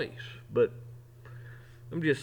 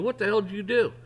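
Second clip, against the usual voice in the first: boss going off; 6 semitones higher than usual. Retold or imitated. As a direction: chew the worker out, half-acted. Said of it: "and he just goes off".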